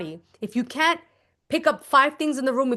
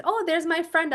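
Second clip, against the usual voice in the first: ordinary talk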